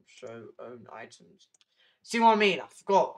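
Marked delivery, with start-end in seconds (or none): 2.0-3.2 frustrated outburst. Real, not acted.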